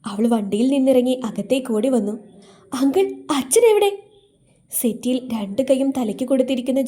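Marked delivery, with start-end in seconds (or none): 2.7-3.9 put-on girl's voice, much higher, anxious; anxious daughter. A narrator's reading. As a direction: call out for her father in worry. (ആകുലയായ മകൾ)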